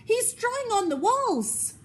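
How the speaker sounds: high, loud telling-on cry; tattling yell. A narrator's reading. Boy telling Mum his brother drew on walls, loud. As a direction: shout it to get him in trouble. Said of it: "said horrid Henry".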